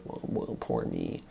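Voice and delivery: deep, soft, self-pitying moan; whiny victim. Retold or imitated. Coach mocks a depressed man whining poor me, low and soft. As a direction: sink into a pitiful sulk. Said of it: "they get in the victim mode".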